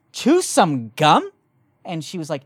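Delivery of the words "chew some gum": slow, louder incredulous repeat; disbelieving echo. Retold or imitated. Repeating the cashier's advice back, slow and incredulous. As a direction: drag it out in disbelief. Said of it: "And I said".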